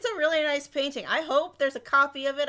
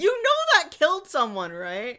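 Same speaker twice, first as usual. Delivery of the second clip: loud, high, acted disbelief; mock alarm; 4 semitones higher, 5 dB louder, slower than usual. Co-host voicing alarm at the poison huffing, appalled. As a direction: exclaim as if appalled at him.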